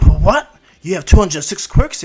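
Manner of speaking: loud, startled disbelief; shocked classmates. Retold or imitated. Classmates react to quirk count.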